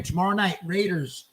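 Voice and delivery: normal talking voice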